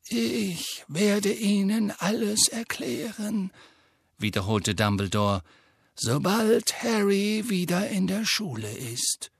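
put-on calm, measured old wizard; Dumbledore voice. A narrator's reading.

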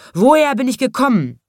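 high, loud roared question; lion roaring. A narrator's reading. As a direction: bellow the question until someone comes. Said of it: "Da brüllte der Löwe so lange". (laut brüllender Löwe)

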